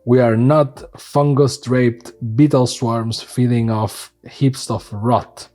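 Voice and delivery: low, slow, ominous letter reading; dramatic reading. Acted. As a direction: read it like a dark proclamation. Reads the Golgari letter aloud.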